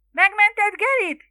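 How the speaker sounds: high, loud, long-drawn shriek; howled cry. A narrator's reading. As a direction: howl it over the crowd. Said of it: "süvöltötte mama újfent a tömeg mögött". (süvöltő, elnyújtott, magas kiáltás)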